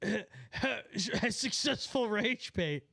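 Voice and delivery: mock-triumphant giggling drawl; laughing gloat. Real, not acted. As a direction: savour every word through giggles. Gloating over baiting a viewer.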